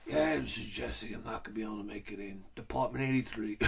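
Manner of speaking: a deep groggy morning voice calling in sick. Retold or imitated.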